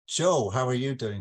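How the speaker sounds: ordinary talk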